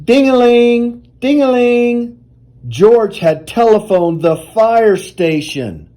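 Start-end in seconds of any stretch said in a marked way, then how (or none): 0.0-2.2 high, sing-song imitation of a phone bell; ringing telephone. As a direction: ring it out like an old telephone.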